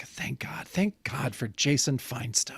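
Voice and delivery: breathy whispered relief; relieved whisper. Retold or imitated. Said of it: "I was like".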